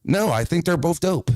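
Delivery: ordinary delivery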